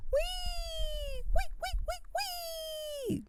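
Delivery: tiny high-pitched squeal; squeaky wee. Retold or imitated. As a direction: squeal like the little pig in the ad. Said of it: "that old Geico commercial".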